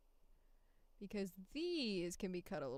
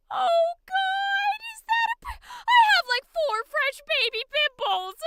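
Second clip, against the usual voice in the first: high, loud panicked wail; panicked shriek; 18 dB louder than usual. Acted. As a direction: shriek in cartoon horror. Panics over pimples.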